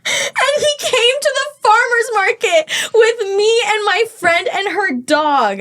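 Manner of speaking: slow, high, laughing through the words; can barely get it out for laughing; laughing in disbelief. Real, not acted. Telling friends the date came along, laughing.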